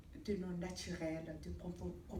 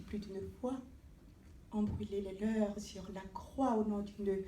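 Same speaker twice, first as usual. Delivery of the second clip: declamatory verse with measured pauses; poem recitation; 6 dB louder than usual. Acted. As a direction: recite it as an impassioned plea, swelling then falling at stanza ends. Recites own poem aloud.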